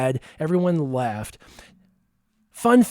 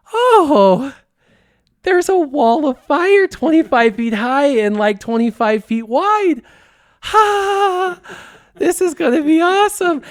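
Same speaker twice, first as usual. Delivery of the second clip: excited; 10 semitones higher, 4 dB louder than usual. Retold or imitated. Excited self-quote seeing the fire.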